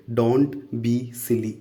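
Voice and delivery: very slow, word by word; slow teacher demo; slow model phrase. Shown as a demonstration. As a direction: say it slowly for the class to copy. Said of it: "तो उसको English में बोलेंगे".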